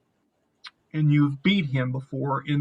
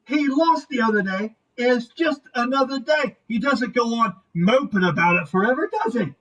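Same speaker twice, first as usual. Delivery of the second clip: high, raised scolding call; needling yell in a higher woman's voice; yelling over shoulder; 7 semitones higher than usual. Acted. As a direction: holler a jab at someone out on the porch. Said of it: "She yells over her shoulder".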